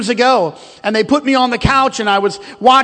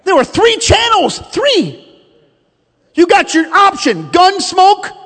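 fiery preaching; 5 semitones higher than usual. Acted. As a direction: hammer the point home to the congregation. Preaching at full force.

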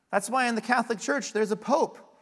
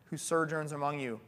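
scripture reading; 3 semitones lower than usual. Acted. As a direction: read the passage gravely. Reads scripture aloud.

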